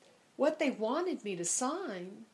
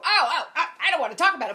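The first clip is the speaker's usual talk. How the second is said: higher, louder, hurried brush-off; flustered refusal; 6 semitones higher, 10 dB louder, faster than usual. Retold or imitated. Stuck in the window seat, she flusters off the stranger, loud. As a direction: bat the topic away in a nervous rush. Dodging seatmate's rapture talk.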